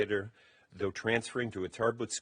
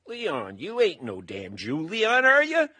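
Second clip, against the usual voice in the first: Southern Arkansas twang, climbing to a much higher, louder "are you"; 8 semitones higher, 10 dB louder, slower than usual. Retold or imitated. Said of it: "in perfect twang".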